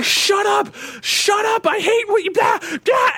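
high-pitched imitation of a furious shout; screaming retort. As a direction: shriek it like someone losing it on players. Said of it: "he's just screaming".

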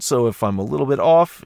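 ordinary talk, nothing marked

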